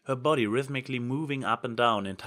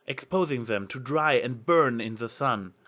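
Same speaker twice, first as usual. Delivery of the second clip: ordinary delivery, nothing marked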